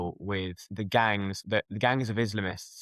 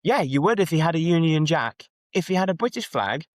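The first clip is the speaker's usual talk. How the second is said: put-on higher-pitched, insistent Manchester accent; 7 semitones higher, 4 dB louder than usual. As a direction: imitate a protesting Manchester man, pitched up. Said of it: "the Manchester guy, he says".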